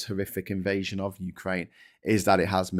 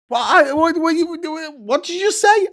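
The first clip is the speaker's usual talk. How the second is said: high, loud spluttering disbelief; shocked elder; 8 dB louder than usual. Retold or imitated. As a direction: splutter in outrage at the word. Shocked elder reaction voiced.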